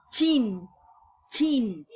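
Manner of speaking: "tin" said loud and stretched; teen ending. Shown as a demonstration. Teacher stressing the teen ending to students, loud. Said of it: "tienen la terminación".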